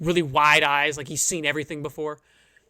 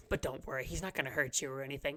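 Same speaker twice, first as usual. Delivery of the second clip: much softer, quick reassurance; hushed aside; 3 semitones lower, 11 dB quieter, faster than usual. Acted. Frog greeter quietly reassuring the big adventurer, hushed. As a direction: drop the voice to calm him. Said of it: "he grabs your hand and goes".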